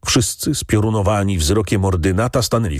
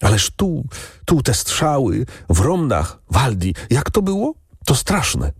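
higher-pitched, anxious questioning in broken phrases; fearful cry; 7 semitones higher than usual. A narrator's reading. Granddaughter asking Waldi about the shooting, fearful cry. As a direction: call out in alarm, voice raised in pitch. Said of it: "zawołała z lękiem".

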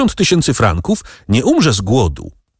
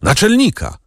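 high desperate cry for the chief; a high, pleading shout of Naczelnika; shouted call; 10 semitones higher than usual. A narrator's reading. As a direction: call out in despair. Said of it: "krzyknął".

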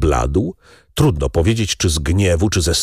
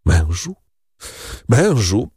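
ordinary delivery, nothing marked

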